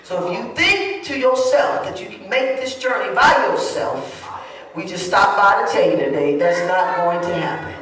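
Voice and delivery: rising sermon call. Acted. Preacher warning the congregation they cannot walk alone, building into a loud preach.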